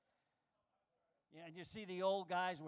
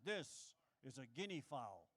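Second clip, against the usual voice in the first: narrator voice; 5 semitones lower, 3 dB quieter, slower than usual. Retold or imitated. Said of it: "The guy said".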